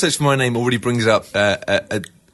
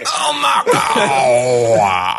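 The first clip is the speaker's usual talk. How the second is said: slow shout; 4 dB louder, much slower than usual. Pretend.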